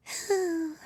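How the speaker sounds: high, drawn-out little sigh; mouse sigh. A narrator's reading.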